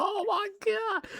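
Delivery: very high, slow and soft oh my God; a breathless falsetto of disbelief; stunned gasp. Retold or imitated. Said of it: "I'm like".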